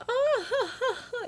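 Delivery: high-pitched, put-on giggle; fake girly laugh.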